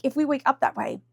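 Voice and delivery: normal talking voice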